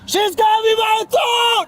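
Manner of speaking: high, desperate yell; panicked shout. Real, not acted. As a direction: scream it like you're being choked. Man struggling during arrest.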